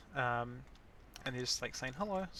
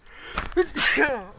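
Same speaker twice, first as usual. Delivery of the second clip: sudden loud cough; 13 semitones higher, 14 dB louder than usual. Real, not acted. Sneeze building, coughs.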